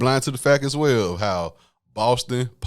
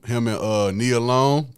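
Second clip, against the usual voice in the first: ordinary delivery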